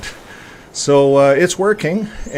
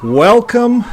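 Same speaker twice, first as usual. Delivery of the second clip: higher, louder drawn-out welcome; 11 semitones higher, 8 dB louder than usual. Real, not acted. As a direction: call out the welcome up high. Greeting viewers at show start.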